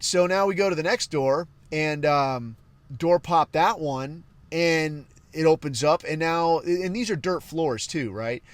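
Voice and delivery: ordinary talk, nothing marked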